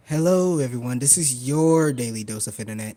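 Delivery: mock announcer. Doing the Daily Dose of Internet intro, booming mock announcer. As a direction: boom it like a show host. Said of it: "imitating show intro".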